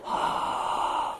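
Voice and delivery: heavy Darth Vader breathing. Acted. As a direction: breathe heavily like a masked villain. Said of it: "Like Darth Vader's on the phone".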